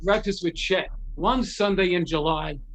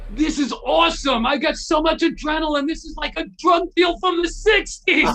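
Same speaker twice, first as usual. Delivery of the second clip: higher and louder, building to a shout; hyped up; 6 semitones higher, 4 dB louder than usual. Retold or imitated. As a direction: rush it out buzzing with adrenaline. Tommy pumped on adrenaline.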